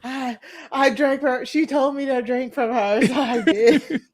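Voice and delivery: mock drunken confession, breaking into laughs; drunk or high. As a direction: act woozy through held-back laughter. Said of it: "like, drunk or high".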